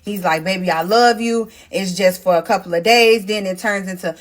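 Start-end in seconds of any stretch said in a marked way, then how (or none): none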